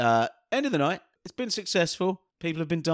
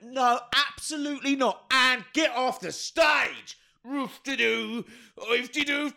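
high, slow, silly put-on voice; hammed-up silly dismissal; mock DJ rant; 9 semitones higher, slower than usual. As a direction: shoo her off in a goofy voice. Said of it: "And I went".

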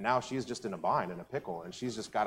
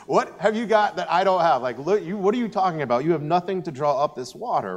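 higher, louder mock disbelief; scoffing woman at the well; 6 semitones higher, 7 dB louder than usual. Preacher voicing the Samaritan woman scoffing at Jesus, higher and louder. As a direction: retell her scoffing at the stranger with no bucket. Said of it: "The woman's like".

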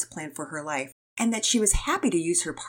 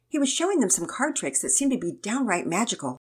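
usual speaking voice

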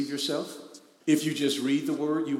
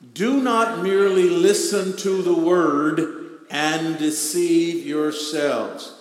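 loud, slow scripture reading; reading scripture with power; 3 semitones higher, 8 dB louder, slower than usual. Acted. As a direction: proclaim it like the word of power. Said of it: "let's read that like we know it is the word of his power".

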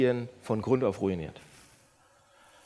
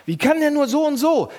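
louder, higher, contemptuous judgment; scornful complaint; 9 semitones higher, 6 dB louder than usual. Shown as a demonstration. Pastor acting out judging another driver. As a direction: sneer at the bad driver. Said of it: "Merkt ihr das?".

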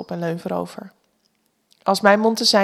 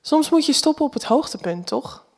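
higher-pitched, tentative, softening into the tag question; hesitant; 6 semitones higher than usual. A narrator's reading. Woman defending herself to Jack, hesitant. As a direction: offer it unsurely, seeking agreement. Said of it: "zeg ik aarzelend".

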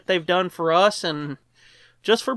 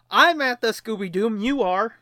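ordinary delivery

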